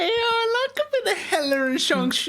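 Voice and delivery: high gleeful squeal with a laugh; excited. Real, not acted. Co-host gushing about a favourite pairing, excited squeal. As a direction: squeal with delight. Thrilled by Hela and Shang-Chi.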